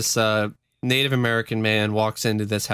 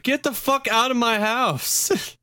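high, slow, outraged mock shout; 11 semitones higher, slower than usual. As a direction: drag out the order to leave. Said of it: "I'd be like".

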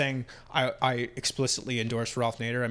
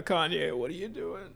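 lightly attempted politician impression, higher pitch; Bill Clinton; 5 semitones higher than usual. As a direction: mildly imitate an ex-president. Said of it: "I was trying to do Bill Clinton".

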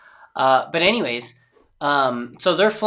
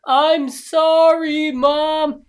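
slowed, stretched high voice; slow motion; 13 semitones higher, 4 dB louder, much slower than usual. Pretend. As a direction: speak like slow-mo film. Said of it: "slow-mo conversation".

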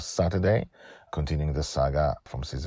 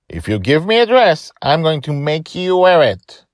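much higher, louder character voice; Colin laying down the law; 13 semitones higher, 12 dB louder than usual. Acted. Colin warns Joy about the dress, high forceful voice. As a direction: push it up high and loud as an order.

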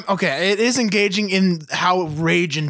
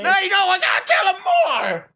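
high, fast, desperate shout; hammed-up frantic protest; crazy guy dragged away; 14 semitones higher, faster than usual. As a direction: yell it while being hauled off. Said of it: "If a crazy guy just burst the fuck in".

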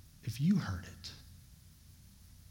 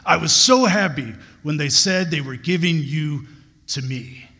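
an excited coach calling Simmons; coach's excited greeting; 6 dB louder than usual. Retold or imitated. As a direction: greet the player with real delight, loud. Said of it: "and goes".

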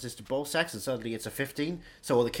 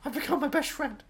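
silly high voice; 13 semitones higher than usual. Pretend. High put-on voice continues.

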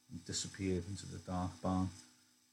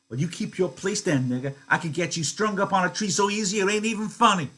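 high, loud contemptuous threat; wife threatening to have Crooks lynched; vicious wife; 11 semitones higher, 10 dB louder than usual. Acted. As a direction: crush him with the threat.

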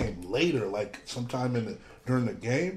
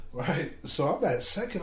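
ordinary talk, nothing marked

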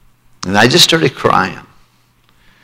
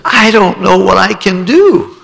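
put-on tearful boy; 9 semitones higher, slower than usual. Boy answers while crying.

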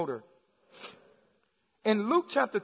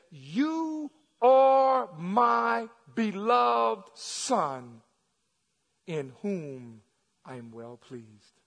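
voice from heaven; much slower than usual. Acted. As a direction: intone it as God, stretching each word. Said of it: "a voice came from heaven and said".

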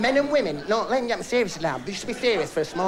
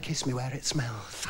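lower, much softer and slow; girlfriend voice; 6 semitones lower, 9 dB quieter, slower than usual. Acted. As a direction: breathe the line low and slow.

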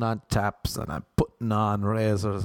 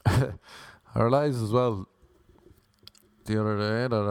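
ordinary talk, nothing marked

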